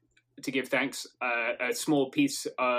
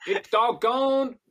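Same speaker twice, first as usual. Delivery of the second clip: slow, high, hammed-up Southern drawl; Southern accent; 8 semitones higher, much slower than usual. Player narrating his success in character, exaggerated slow Southern accent. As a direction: draw it out in a put-on Southern accent. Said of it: "And what would he say".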